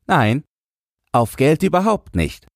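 put-on high, scornful little boy's voice; contemptuous boy. A narrator's reading. Jürgen dismisses the man's money guess, contemptuous. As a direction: brush the man off with childish contempt. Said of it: "sagte Jürgen verächtlich".

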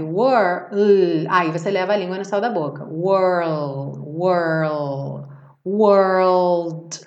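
were, were, then whirl, then world, tongue curled then up; world in parts. Shown as a demonstration. Teacher walks students through world step by step. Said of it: "você pensa primeiro em parar no R".

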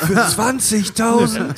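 high slow laughing disbelief; shocked disbelief. Retold or imitated. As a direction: balk at the price. Outraged accountant quote.

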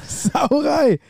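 squeaky echo of the word; high mock outrage. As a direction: copy it high and jokey. Echoes the same word even higher.

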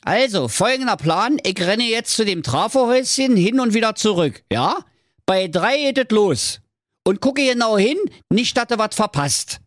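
high, brisk setup of a trick; scheming web designer. Acted. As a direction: lay out the plan eagerly. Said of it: "Lars Lutz Loderhose".

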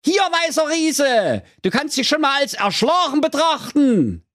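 boastful prince. A narrator's reading. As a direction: call it out like a cocky hero. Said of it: "Er rief".

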